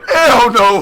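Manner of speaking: loud emphatic denial; emphatic indignation. Real, not acted. Friend agreeing it's not fair, loud indignant denial. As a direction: hit it hard and indignant.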